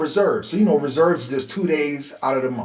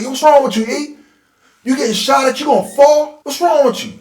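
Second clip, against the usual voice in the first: higher, louder retold yell of the scared older brother; retold brother's yell; 9 semitones higher, 6 dB louder than usual. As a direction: shout it at the little brother who fell. Older brother yelling after shooting.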